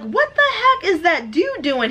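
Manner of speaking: slow, high baffled question; lingering high confusion. Retold or imitated. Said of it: "we were like".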